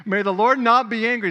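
loud, high NOT pressed hard; urgent plea. Acted. Abraham begging the Lord not to be angry, loud urgent plea. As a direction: beg loudly so God won't be angry.

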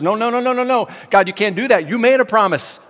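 urgent pleading, high and loud; Moses. Acted. Moses intercedes with God for the people, urgent protest. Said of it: "Moses goes and intercedes".

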